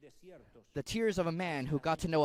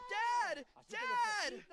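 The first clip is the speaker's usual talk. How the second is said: put-on much higher, a child's cry of "Dad!"; boy calling out; 4 dB quieter than usual. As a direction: call to your father as a frightened boy. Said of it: "the boy shouts and screams".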